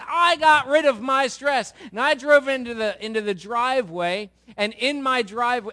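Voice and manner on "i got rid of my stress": slow, proud and much louder; savoring the victory out loud; proudly announcing his clever fix; smug relief. Retold or imitated. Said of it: "taking that home thinking".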